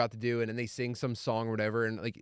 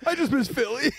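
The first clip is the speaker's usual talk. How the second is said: high whiny mock crying voice; mock crybaby; 12 semitones higher, 4 dB louder than usual. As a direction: whine it like a crybaby, laughing. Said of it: "mocking the crier".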